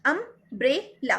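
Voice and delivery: Umbrella slowly as um, brel, la; broken into syllables. Shown as a demonstration. Teacher asking how many syllables, broken into syllables. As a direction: pause between the three pieces of umbrella. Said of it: "यहाँ पर कितने syllable हैं".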